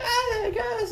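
put-on high-pitched little English kid voice; mock English accent. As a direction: squeal it as a whiny-kid English caricature. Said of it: "He talked with the English accent".

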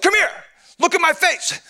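high-pitched playful holler; mock yell. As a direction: summon him with a hammed-up yell. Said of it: "Kinda yelled at him again".

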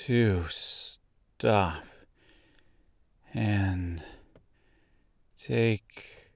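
very drawn-out, soft, sleepy yawning speech; each word dragged out through a yawn; too drowsy to finish, long gaps between words; big yawn. A narrator's reading. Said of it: "she said with a big yawn".

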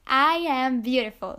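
said out loud with conviction. Shown as a demonstration. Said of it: "come on, I can't hear you".

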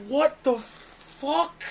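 drawn-out disbelief at what you just saw; stunned slow question; incredulous. Retold or imitated. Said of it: "I'm like".

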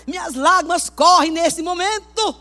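declamação chorosa, alta, aguda e lenta; lamento dramático. Acted. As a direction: declame devagar e alto, com drama exagerado. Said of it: "Eu sempre fui dramática". (lamento melodramático)

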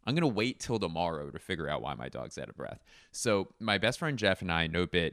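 ordinary talk, nothing marked

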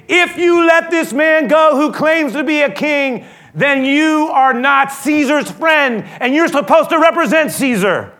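high, loud, drawn-out accusation; accusing shout. Acted. The crowd pressuring Pilate with Caesar's name, slow accusing shout. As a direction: hurl it at the governor as a crowd. Crowd plays the big card.

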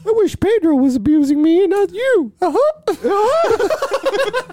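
squeaky high mocking voice; high put-on voice. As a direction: say it in a squeaky falsetto.

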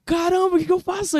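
high-pitched acted alarm; mock-panicked, pitch jumping up; retold panic. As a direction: play back a startled inner cry. Retelling his panic on stage.

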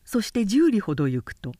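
plain reading voice

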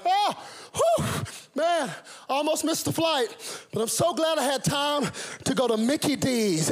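high, breathless relieved passenger; late passenger. Retold or imitated. Preacher imitating the late passenger, high relieved voice. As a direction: play the late man who just made it, voice up high. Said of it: "they're like".

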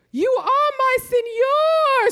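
high, slow, hammed-up Spanish accent; mock Spanish lover's cry; Spanish accent scream. A narrator's reading. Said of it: "screams Olivia in a Spanish accent".

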